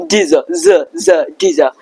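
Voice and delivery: dizza with a buzzing z in the middle, then z alone. Shown as a demonstration. Teacher drilling the did-you z sound.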